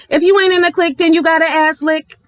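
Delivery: taunting the clique in a high mocking voice; mocking singsong.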